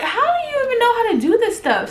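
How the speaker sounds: high, laughing and quick; laughing disbelief. Retold or imitated. Mother amazed at her baby, laughing and high. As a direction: ask it through a laugh, amazed. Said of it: "And I'm just like".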